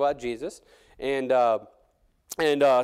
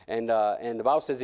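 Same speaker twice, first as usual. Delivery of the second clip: usual speaking voice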